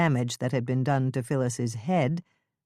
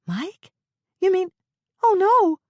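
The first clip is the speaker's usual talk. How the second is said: alarmed Lisa; 7 dB louder than usual. Acted.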